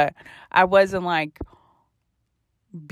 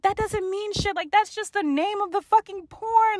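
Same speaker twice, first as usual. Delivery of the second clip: pitched way up, protesting; high defensive protest; 12 semitones higher, 4 dB quieter than usual. Retold or imitated. Daughter protests to her parents, high and defensive. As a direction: squeal out the denial.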